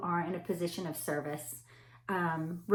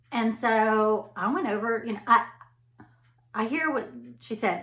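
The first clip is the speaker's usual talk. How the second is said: usual speaking voice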